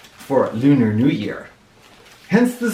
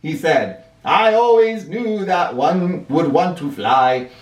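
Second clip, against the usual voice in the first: put-on old Fu voice; 9 semitones higher than usual. Old Fu speaks.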